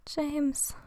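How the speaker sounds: soft, hushed murmur of a name; quiet, near-whispered narration of her words. A narrator's reading. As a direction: breathe the name out softly. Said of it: "wisperte sie".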